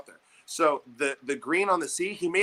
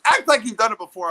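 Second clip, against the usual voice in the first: stern coach; 7 semitones higher, 7 dB louder than usual. Retold or imitated. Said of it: "my coach goes".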